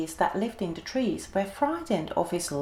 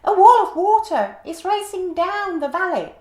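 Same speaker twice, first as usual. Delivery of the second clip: much higher, loud squeaky warning; a gentle giant with a tiny squeak; squeaky giraffe voice; 11 semitones higher, 10 dB louder than usual. A narrator's reading. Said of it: "in a surprisingly squeaky voice".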